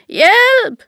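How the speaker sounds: high, loud cry for help; screaming for help. A narrator's reading. King in a hole screams for help, high and loud. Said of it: "skreg han".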